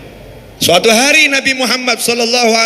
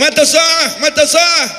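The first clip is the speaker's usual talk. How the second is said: higher, raised calling out; villager shouting; 7 semitones higher, slower than usual. Retold or imitated. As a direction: call over the crowd to the Prophet. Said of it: "karena berteriak pada Nabi nggak boleh".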